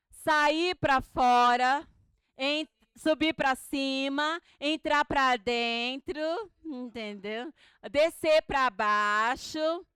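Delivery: loud, slow, punching each pleonasm; emphatic examples. Shown as a demonstration. Teacher listing pleonasms to the class, loud and slow. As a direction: lay out each example deliberately. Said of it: "que que é pleonasmo?".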